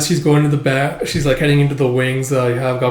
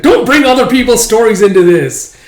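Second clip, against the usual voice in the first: loud, higher-pitched angry telling-off; irate dad, voice raised hard; scolding father; 8 semitones higher, 8 dB louder than usual. Acted. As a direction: scold your child in exasperation.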